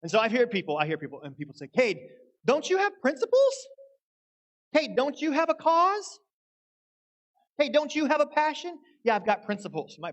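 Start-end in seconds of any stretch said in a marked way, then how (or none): 1.7-8.8 high, loud imitation of indignant questioners; mock challenge. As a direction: pester in a shrill-pitched, raised voice. Acting out people challenging him.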